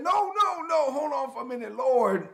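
very high, strained no; protesting Martha. Retold or imitated. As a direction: squeal out a frantic objection. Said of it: "Martha said".

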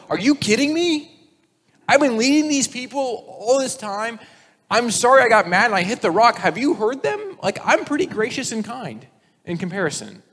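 a mock indignant Moses; exasperated outburst. Preacher as Moses protesting to God, indignant. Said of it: "I'm going".

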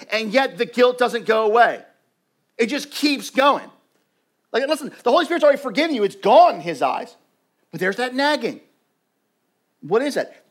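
loud, rising sermon delivery; preaching at full force. Acted. Pastor urging the church about nagging guilt, loud preaching. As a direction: drive it home to the room.

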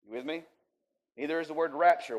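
ordinary talk

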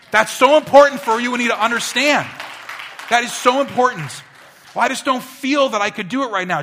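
loud, high, driving sermon voice; fiery pulpit preaching; preaching at full force. Acted. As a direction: preach it out to the whole room.

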